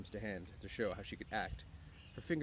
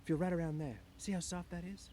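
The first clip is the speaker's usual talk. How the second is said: lightly voiced, higher-pitched invitation; a lilting, raised-pitch coaxing line; character's inviting voice; 9 semitones higher than usual. A narrator's reading. Woman invites Lenny to stroke hair.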